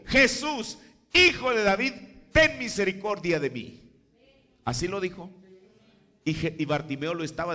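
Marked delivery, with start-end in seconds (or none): 0.1-3.8 higher and louder, a cry from the roadside; shouted plea. Retold or imitated. Bartimaeus shouting to Jesus in Jericho, loud pleading cry. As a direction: call out to Jesus for mercy. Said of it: "gritó y dijo".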